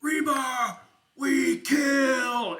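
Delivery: loud, high battle shout; orc war shout. Acted. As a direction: yell it to send the horde marching. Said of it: "and then he goes".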